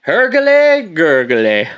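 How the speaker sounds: mock gargle. Real, not acted. Gargling after vodka shot.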